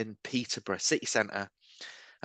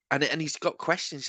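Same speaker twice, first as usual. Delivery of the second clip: normal talking voice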